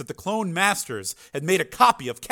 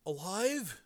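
high but soft, dazed question; foggy; 6 semitones higher, 10 dB quieter than usual. A narrator's reading. Dazed brother echoing Frank, foggy voice. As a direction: echo "Alive" faintly, as if befuddled. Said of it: "Joe said foggily".

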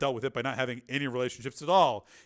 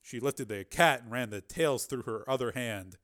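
plain reading voice

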